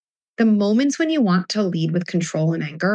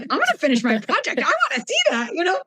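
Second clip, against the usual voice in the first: much higher, eager keen-employee voice; eager excitement; 9 semitones higher, faster than usual. Acted. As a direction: sound thrilled to finish the project.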